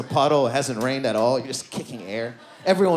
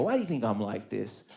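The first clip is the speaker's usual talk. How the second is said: asking therapist; 5 semitones lower, 7 dB quieter than usual. Retold or imitated. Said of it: "I go".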